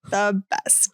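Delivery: ordinary talk, nothing marked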